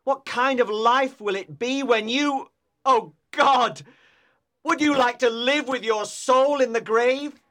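impassioned accusing lover; tormented outpouring, stressing the key words; anguished cry. A narrator's reading. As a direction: hurl the reproach with heavy emphasis. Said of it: "he cried".

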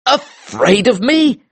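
high, drawn-out, astonished question; shocked and indignant, slow and high; incredulous cry. Acted. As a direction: cry out in disbelief, pitch way up. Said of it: "I cried".

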